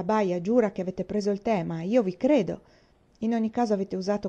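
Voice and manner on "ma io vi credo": plain reading voice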